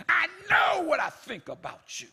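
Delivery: loud, high indignant shout. Acted. Preacher voicing God, full of indignation. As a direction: put some indignation in it. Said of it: "He can put some indignation in it".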